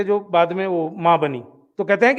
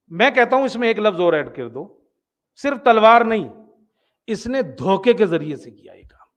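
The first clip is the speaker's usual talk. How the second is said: normal talking voice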